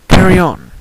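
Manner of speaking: high, loud command, falling at the end; loud dismissal. Acted. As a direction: call it out high and forceful. Character breezily dismisses the server aloud.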